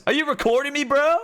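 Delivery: high, loud squeaky accusation; hammed-up high-pitched bro; mock suspicious bro. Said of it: "he goes".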